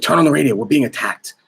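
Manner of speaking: imitating a frightened colleague pressing him; urgent alarm. Colleague urges news of attack.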